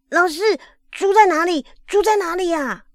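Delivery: put-on high, eager child's question; 高兴地问. A narrator's reading. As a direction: ask excitedly, pitch jumping high, innocent. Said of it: "立刻高兴地问". (高兴又天真地高声追问)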